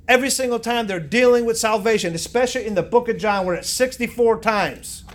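loud, insistent sermon delivery; forceful preaching. Real, not acted. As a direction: press the argument hard, slowing at the end.

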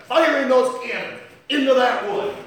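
a rising pulpit cry; loud preaching. Acted. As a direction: drive the cannons home. First take of cannon line.

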